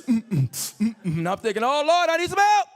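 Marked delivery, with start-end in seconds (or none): 1.6-2.7 high, loud mock cry for help; panicked plea. High comic man calls on the Lord for help, panicked. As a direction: wail it out like you're desperate.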